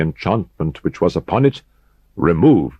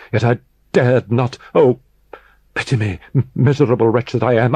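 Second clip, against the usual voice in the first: climbing higher, faster, more desperate; rising frantic confession; 4 semitones higher than usual. Acted. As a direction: panic swelling as the guilt spills out. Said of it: "the superhuman energy of his utterance".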